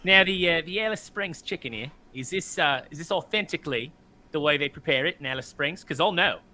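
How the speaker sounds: lightly put-on Aussie accent; Australian accent. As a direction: imitate an Australian quizzing restaurant staff. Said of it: "go into Outback and, and, uh, and, and be like".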